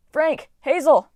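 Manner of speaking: high, loud calls; shouting names. A narrator's reading. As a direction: shout to warn friends. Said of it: "Leo shouted".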